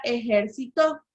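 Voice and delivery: ejército with the final "to" stressed; stress on last syllable. Shown as a demonstration. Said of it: "en la última sílaba".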